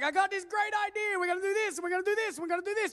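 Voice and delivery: high, eager voice of God pitching a plan; excited God. Acted. Preacher voices God pitching an eager plan, high and excited. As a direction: play God bursting with a big idea.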